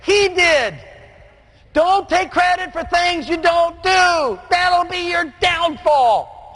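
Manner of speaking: high, slow, driving preacher's build; full-force preaching. Acted. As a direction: preach it to the back row, drawing each phrase out.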